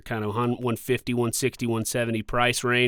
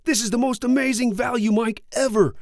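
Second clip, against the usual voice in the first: high-pitched, over-the-top awed gushing; mock frenzy of bargain hunters, voice pitched way up; excited crowd reaction; 11 semitones higher than usual. Imitating buyers stunned by a cheap mic price, hammed-up excitement. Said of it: "people were just like".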